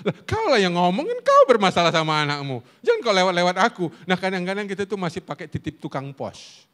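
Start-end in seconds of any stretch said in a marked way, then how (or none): 0.1-3.8 loud, higher mock retort; hammed-up indignant pushback; exasperated wife. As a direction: imitate a wife refusing to pass messages on. Acts wife's annoyed retort.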